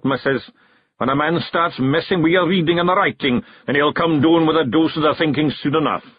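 Scots clansman, diagnosing. A narrator's reading. Big Yan gives Jeanie his diagnosis of Rob, knowing. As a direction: pronounce the diagnosis in broad Scots.

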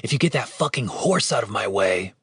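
irritated undertone; tense, annoyed hiss; hissed retort. A narrator's reading. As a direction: hiss the demand at a friend. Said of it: "Dave hissed back at him".

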